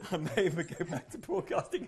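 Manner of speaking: laughing. Real, not acted. Still delighted after swazzle.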